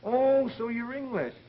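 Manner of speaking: high, loud delighted exclamation. Acted. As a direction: exclaim with sudden joy. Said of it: "Oh, I'm so happy".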